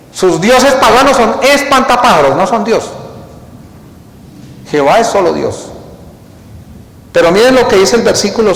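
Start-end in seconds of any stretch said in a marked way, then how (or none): none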